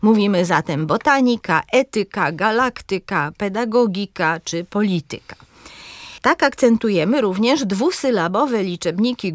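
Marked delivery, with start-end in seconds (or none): 0.9-5.3 akcent na TA, E, LAK, GO, LI w „botanika, etyka, galaktyka, pedagogika, polityka”; akcent proparoksytoniczny. Shown as a demonstration. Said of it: "kiedy akcentujemy trzecią sylabę". (starannie wyliczone „botanika, etyka”, z mocną trzecią sylabą od końca)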